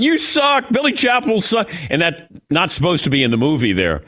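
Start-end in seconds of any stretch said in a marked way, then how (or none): 0.0-1.7 high-pitched mock yell of New York hecklers; imitating heckling yell. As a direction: call it out high, like a rowdy film extra jeering. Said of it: "these New Yorkers are yelling out".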